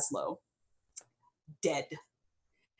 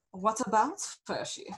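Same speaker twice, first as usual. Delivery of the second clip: lady leader's retort; 6 semitones higher than usual. A narrator's reading. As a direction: toss the question back, unhurried and lofty. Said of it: "she says".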